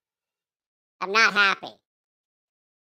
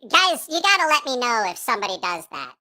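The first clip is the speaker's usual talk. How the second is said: ordinary talk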